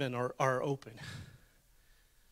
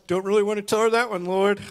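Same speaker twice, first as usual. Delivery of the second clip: louder, quick, mock-whiny refusal; hammed-up reluctant prayer; playful reluctance; 3 semitones higher, 6 dB louder, faster than usual. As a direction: plead with the Lord not to make you say it. Reluctant plea to God, repeated.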